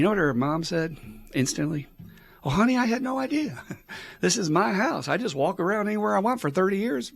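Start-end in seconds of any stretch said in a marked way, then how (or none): 2.4-7.1 high-pitched imitation of a surprised mother; apologetic mom. Voicing mom reassuring her daughter, surprised high voice. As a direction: lift the voice high, playing an innocent mom.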